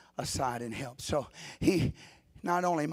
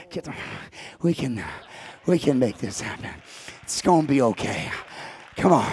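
gently encouraging; 2 semitones lower, 11 dB louder than usual. Pretend. Advocate comforting a tempted sinner, gently encouraging. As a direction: coax the struggling man along.

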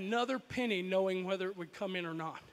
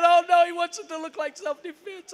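1 panicked thought; 6 semitones higher, 8 dB louder than usual. Retold or imitated. Terrified kid's racing thought.

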